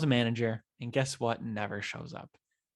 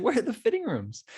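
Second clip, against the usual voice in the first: high-pitched, frustrated call; exasperated calling out; 11 semitones higher, 3 dB louder than usual. Acted. Lost shopper searching for fitting rooms, exasperated call. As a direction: call across the store, pitched way up.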